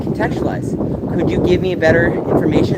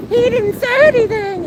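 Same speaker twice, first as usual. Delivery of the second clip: high, whiny mock voice, much higher; mocking whiny gaslighter. Streamer mocking people who twist silence, high whiny mimic. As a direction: squeak it like a petty accuser.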